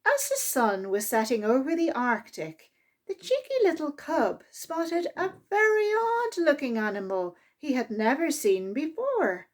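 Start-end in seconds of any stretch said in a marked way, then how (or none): none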